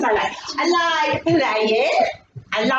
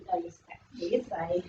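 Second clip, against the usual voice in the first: quiet; 9 semitones lower, 13 dB quieter than usual. Acted. Quiet mocking aside.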